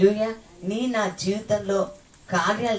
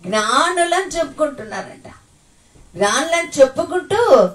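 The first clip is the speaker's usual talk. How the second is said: higher, building preaching emphasis; emphatic preaching; 7 semitones higher, 6 dB louder than usual. Real, not acted. Preacher mocking the worldly wise, building. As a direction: drive the point home.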